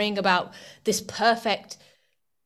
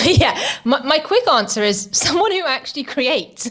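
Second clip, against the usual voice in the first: laughing; 6 semitones higher, 6 dB louder than usual. Real, not acted. Guest laughs while answering.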